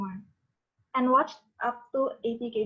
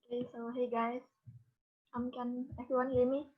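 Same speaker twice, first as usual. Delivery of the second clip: very quiet, far from the mic; speaking too softly to hear; barely audible; 6 dB quieter than usual. Real, not acted. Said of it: "your voice is, uh, very, uh, low".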